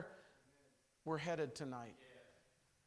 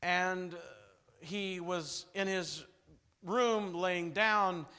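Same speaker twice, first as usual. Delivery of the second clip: normal talking voice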